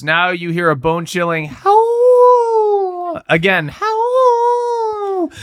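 long, high wolf-like howl. Acted. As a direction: draw the words out into a howl. Reads the howl in the story.

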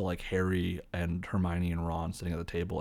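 ordinary talk, nothing marked

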